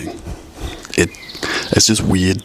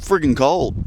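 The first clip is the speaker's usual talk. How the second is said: high exasperated complaint; 7 semitones higher than usual. Real, not acted. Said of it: "it's really friggin' cold".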